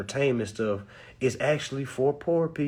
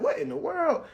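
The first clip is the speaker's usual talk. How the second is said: high, softer, startled "what in the world"; retold surprise; 11 semitones higher, 5 dB quieter than usual. Driver pulled over by cops, high startled surprise. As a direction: mimic his own surprise at being pulled over. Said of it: "I'm like".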